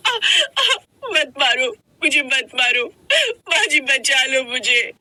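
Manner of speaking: high, tearful begging; crying, pleading wife. Acted. Beaten wife begs her mother-in-law to save her, tearful pleading. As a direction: cry out in pain and plead for mercy.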